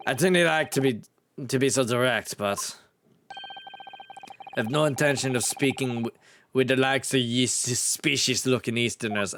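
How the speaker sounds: blunt Scottish governor; Scottish accent. Acted. Governor refusing to speak with Easterners, Scottish accent. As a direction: dismiss them in a Scots brogue. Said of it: "This is a specific Scottish accent".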